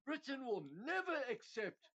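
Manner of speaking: loud, high mock refusal; Liz Truss. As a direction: imitate a politician's indignant outburst, voice jumping up. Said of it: "And she's like".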